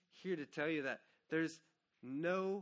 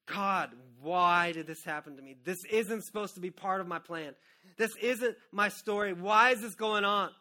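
louder, pleading, slowing at the end; anguished cry to God; 3 semitones higher, 10 dB louder than usual. Retold or imitated. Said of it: "I remember".

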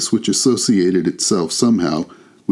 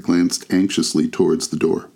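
ordinary delivery

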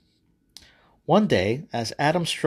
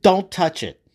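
loud, raised, thunderous command; booming like thunder; 7 semitones higher, 6 dB louder than usual. Acted. God forbidding Adam and Eve the tree, booming. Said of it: "God's voice boomed like thunder".

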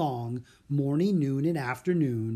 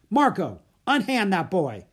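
booming command; 6 semitones higher, 6 dB louder than usual. A narrator's reading. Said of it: "a man's voice boomed".

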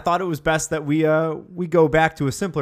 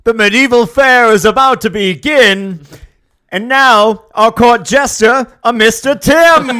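high, loud fairground announcer; herald announcement; 11 semitones higher, 13 dB louder than usual. Acted. Announcing the jester.

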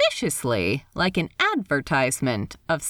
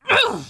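frustrated groan; 4 dB louder than usual. Acted. Ed frustrated outburst.

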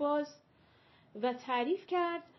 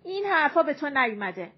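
sharp motherly rebuke, louder and higher; scolding; 4 semitones higher, 7 dB louder, faster than usual. A narrator's reading. Mother scolding her curious daughter, scolding. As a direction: tell the girl off. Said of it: "مادرش تشر زد".

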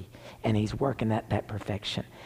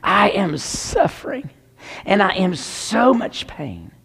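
raised, drawn-out anguished plea, imitated; crying out to God; 8 semitones higher, 4 dB louder, slower than usual. Suffering mother begs God from her bed, retold crying out. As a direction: cry out slowly in imitated suffering, pitched high. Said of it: "she was crying out to God".

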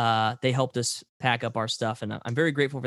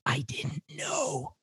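soft conspiratorial hush; a hushed secret for listeners; whispering; 4 semitones lower, 4 dB quieter than usual. Pretend. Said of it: "it's to get people's attention".

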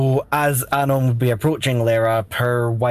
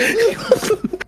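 high laugh. Real, not acted. Laughing at the plan.

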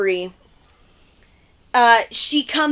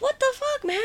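very high, softened squeal of disbelief; mock incredulous; 14 semitones higher, 7 dB quieter than usual. Host voices Ashley shot by a paintball gun, squeaky disbelief. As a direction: whine it as an outraged imitation.

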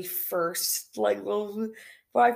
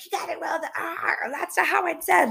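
higher-pitched mock dad correction; imitating a scolding dad; 7 semitones higher than usual. Dad correcting child's Spanish pronunciation, high mock scolding. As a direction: put on dad's voice, pitched up. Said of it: "my dad will be like".

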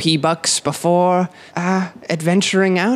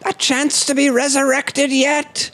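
high hawking preacher voice; preacher calling out; 9 semitones higher, slower than usual. Acted. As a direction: beckon them in eagerly. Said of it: "unwashed preacher".

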